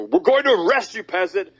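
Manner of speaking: high sneering cop voice; mock police voice. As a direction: ham up a pompous officer. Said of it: "And they go".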